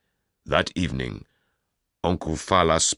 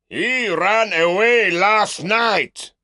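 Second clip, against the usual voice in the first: much higher, louder, slow bluster; loud deceiving uncle; 8 dB louder, slower than usual. Acted. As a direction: lie boldly to the crowd. Uncle lies loudly to the mob.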